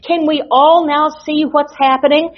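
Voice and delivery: ordinary talk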